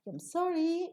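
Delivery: involved, polite apology with SORRY going up; genuine apology. Shown as a demonstration. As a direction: say I'm sorry with a big lift on sorry. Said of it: "a genuine tone if you raise up".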